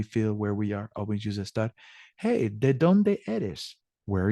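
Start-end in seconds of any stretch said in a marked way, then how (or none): 2.2-3.8 slow model phrase. Shown as a demonstration. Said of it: "model sentence for the class".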